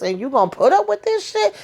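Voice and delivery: high, incredulous imitation; high outraged sass; mock indignant question. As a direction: scold her in a high put-on voice. Danny scolding Mindy in quote.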